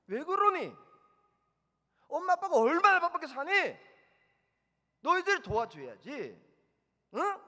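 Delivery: loud lecturing parent voice, pitched high; scolding parent. Retold or imitated. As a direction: lecture the kids loudly like a stern mom. Parent lecturing kids in retold scene. (아이들을 앉혀 놓고 큰 소리로 훈계하는 엄마 목소리)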